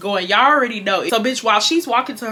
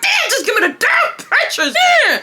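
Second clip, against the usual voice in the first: high-pitched, whiny mock outburst; hammed-up teen snapping at mom; exasperated outburst. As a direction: act out a frantic, squealed demand. Retold outburst at mom over pictures.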